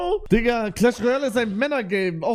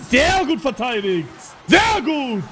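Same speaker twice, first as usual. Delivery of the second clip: hyped yell of good defence; excited cheer; 6 semitones higher than usual. Real, not acted. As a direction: shout praise for the defence.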